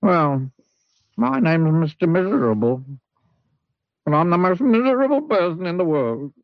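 lightly voiced moping man; gloomy character voice. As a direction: sound like the most miserable fellow alive. Mister Miserable introduces himself.

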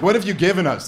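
mock-pushy lady pressing Franklin. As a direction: jab the question at him like a nagging lady. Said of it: "that lady sounds pretty pushy".

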